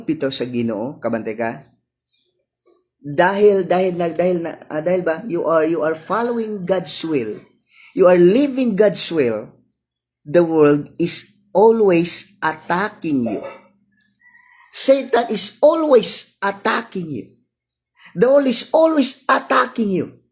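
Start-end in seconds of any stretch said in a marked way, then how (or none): 3.0-13.4 loud, rising sermon cadence; fervent preacher building up; preaching at full force. Acted. Preaching on attack of world. 14.7-20.2 high, loud preaching cry; preacher shouting the refrain; fervent climax. Acted. Repeated sermon climax.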